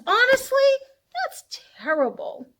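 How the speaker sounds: put-on tut-tut at the naughty dragon for the kids; mock scolding.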